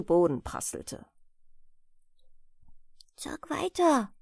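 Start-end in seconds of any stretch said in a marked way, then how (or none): 3.1-4.1 put-on shy little boy, higher whispery voice. A narrator's reading. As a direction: timidly plead in a small high voice. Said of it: "bat jetzt schüchtern das kleinste Büblein". (schüchtern bittend)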